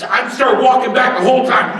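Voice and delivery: ordinary delivery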